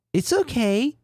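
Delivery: very high falsetto gratitude; squeaky, sugary thanks; Sarah voice. Acted.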